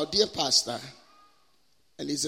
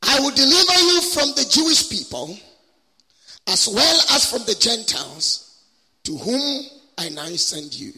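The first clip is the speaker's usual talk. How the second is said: scripture proclamation; 5 semitones higher, 4 dB louder than usual. Acted. Preacher reads scripture to the congregation, solemn proclamation. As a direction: declaim it phrase by phrase, pausing between.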